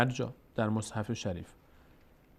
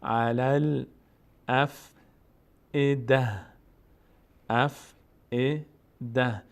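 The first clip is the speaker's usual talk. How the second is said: measured syllables with spaces between; slow recitation demo; much slower than usual. Shown as a demonstration. Teacher breaking the word down, slow recitation. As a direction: recite it slowly, piece by piece.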